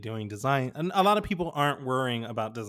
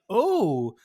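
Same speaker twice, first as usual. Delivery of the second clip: high, louder surprised retelling; mock amazement; 6 semitones higher, 5 dB louder, much slower than usual. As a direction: imitate an over-impressed career coach. Acted reviewer, impressed by each answer.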